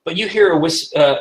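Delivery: ordinary delivery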